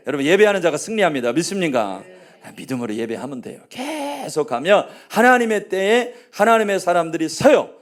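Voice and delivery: rising preacher's proclamation; preaching. Acted. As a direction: proclaim it to the congregation. Preaching to the congregation.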